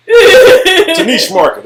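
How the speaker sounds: high, slow, laughing delivery; a giggly, drawn-out punchline. Real, not acted. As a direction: squeeze it out through laughter. Repeated line, high slow laugh.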